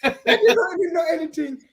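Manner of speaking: loud, laughing disbelief; laughing protest. Real, not acted.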